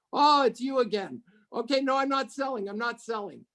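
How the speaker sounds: high-pitched, lightly imitated brush-off; mock exasperation. Retold weary reply to repeat caller.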